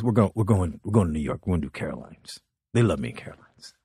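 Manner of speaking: low, fast, offhand mumble; lazy Paul voice. Retold or imitated. Paul invites the comic on tour, low and offhand. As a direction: toss it off like a casual offer. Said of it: "he goes".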